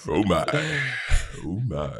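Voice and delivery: very low, drawn-back character voice; put-on deep voice. Having fun doing voices for friends, very deep voice. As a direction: drop it right down for a character catchphrase. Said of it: "God, doing voices is fun".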